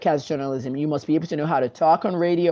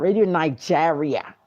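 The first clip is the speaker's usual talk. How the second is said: slow, affected British "Nigeria"; put-on British accent, mocking; 3 semitones lower than usual. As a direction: mimic a fake British announcer. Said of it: "make up a British accent".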